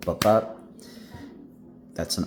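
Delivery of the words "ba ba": ba ba with the second ba stressed; iamb demo. Shown as a demonstration. Teacher showing students an iamb, weak then strong beat. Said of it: "an unstressed syllable followed by a stressed syllable".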